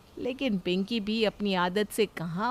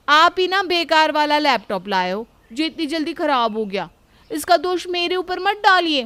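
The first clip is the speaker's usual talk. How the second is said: loud, high, indignant talk-back; defiant retort; 5 semitones higher, 7 dB louder than usual. Acted. Pinki loudly blaming Ajay for buying a bad laptop. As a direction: throw the blame right back at him. Wife blames husband back.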